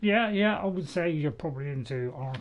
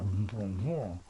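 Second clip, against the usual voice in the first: slow, lower, lightly hammed-up French "bonjour"; mock French greeting; 6 semitones lower than usual. Shown as a demonstration. Gamer shows off his school French: slow mock French accent. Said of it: "a little bit of French there".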